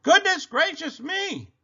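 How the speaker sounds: very high, loud shock; astonished storybook mother; exclaiming. A narrator's reading. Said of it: "and exclaimed".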